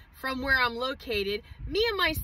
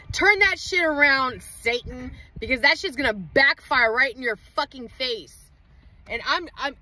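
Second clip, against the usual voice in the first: usual speaking voice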